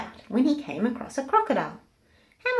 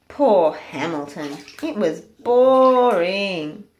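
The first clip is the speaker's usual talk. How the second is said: bored; 8 dB louder, much slower than usual. A narrator's reading. Pig waiting for hours.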